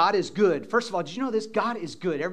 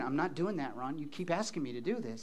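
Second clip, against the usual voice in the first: mock reluctant listener; 6 semitones lower, 12 dB quieter than usual.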